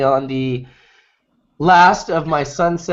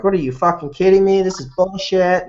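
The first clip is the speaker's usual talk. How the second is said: imitating outraged people, higher and quicker; raised, indignant mock yell; mock angry yelling; 6 semitones higher than usual. Imitating angry crowd after an arrest, mock yelling. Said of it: "they start yelling".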